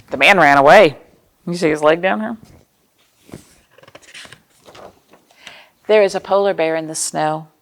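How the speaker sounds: gentle picture-book narration with playful questions; reading to children. Acted. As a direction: read it like story time for little kids.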